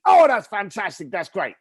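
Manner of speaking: high, loud gushing over the news; matching excitement. Shown as a demonstration. Speaker mirroring the caller's excitement on the phone. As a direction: mirror his excitement with a big Oh. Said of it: "Match the tonality".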